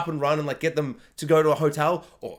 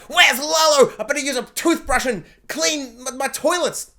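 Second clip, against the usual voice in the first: a sudden high, loud panicky outburst; paranoid Gus; 6 semitones higher, 4 dB louder than usual. Retold or imitated. Reviewer voices Gus panicking about Lalo, high loud outburst.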